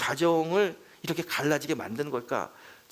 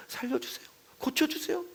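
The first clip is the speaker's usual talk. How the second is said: desperate plea; 13 semitones higher than usual. Retold or imitated.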